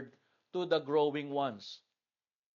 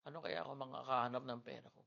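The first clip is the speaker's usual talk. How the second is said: imitating Zach; 3 semitones lower, 10 dB quieter, much faster than usual. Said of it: "Sabi ni Zach".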